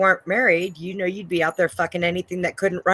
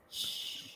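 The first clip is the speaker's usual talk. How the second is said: soft drawn-out shush; 18 dB quieter than usual. Pretend. Host shushes the whispering show, hushed. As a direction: hush everyone gently.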